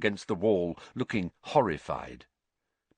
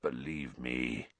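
low, hushed, slow croak; croaked plea; 9 semitones lower, 10 dB quieter, slower than usual. A narrator's reading. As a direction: croak it softly and earnestly.

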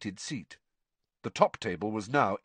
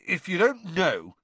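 scornful, higher and pointed; scathing; 8 semitones higher, 4 dB louder than usual. A narrator's reading. Ron refusing to explain to Hermione, scathing. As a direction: throw it out with scorn. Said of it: "said Ron scathingly".

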